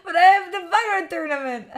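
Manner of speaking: high, loud laugh through the words. Real, not acted. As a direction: laugh it out in disbelief.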